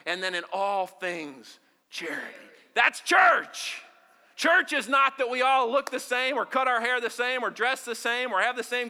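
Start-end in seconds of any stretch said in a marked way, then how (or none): 2.8-6.0 high, loud proclamation; shout. Real, not acted. Preacher proclaims to the congregation, shouting. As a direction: shout it to the whole room. Preacher climax after chant.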